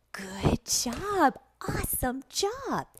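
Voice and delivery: high, gushing teacher praise; exaggerated high-pitched encouragement; excited praise. Shown as a demonstration. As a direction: cheer on a small child. Praising an imagined young student.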